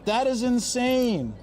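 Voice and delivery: high, slow, loud; slow disbelief. Real, not acted. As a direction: stretch every word in disbelief. Reacting to price.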